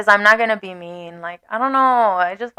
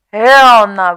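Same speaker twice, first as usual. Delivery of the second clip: loud, horrified hell nah; 12 dB louder than usual. Retold or imitated. On seeing her date, loudly horrified. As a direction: blurt it out in horror.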